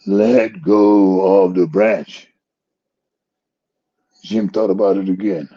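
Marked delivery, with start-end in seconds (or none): 0.1-2.3 slow, deliberate divine command; slow God voice. Retold or imitated. God telling Jim on the cliff to let go, slow and deliberate. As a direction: say it slowly like God.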